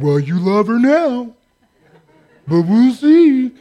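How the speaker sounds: slow, drawn-out gloomy put-down; mock naysayer. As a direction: spoil the good news, dragging the last words. Naysayer spoiling good news.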